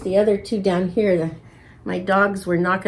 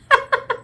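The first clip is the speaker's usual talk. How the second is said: short laugh. Real, not acted. Laugh after crossing fingers.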